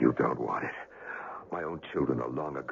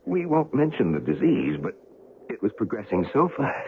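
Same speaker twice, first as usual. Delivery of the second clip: strained outburst; 9 semitones higher, 6 dB louder than usual. Acted. Dying man pushes to speak.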